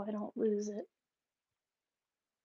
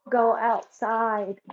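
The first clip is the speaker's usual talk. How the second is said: slow firm command; 7 dB louder, much slower than usual. Real, not acted. Repeating command to dog, slower.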